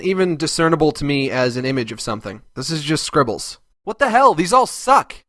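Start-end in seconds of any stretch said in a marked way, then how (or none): 3.8-5.2 exasperated outburst. Acted. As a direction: blow up in disbelief. Sudden outburst at bad art.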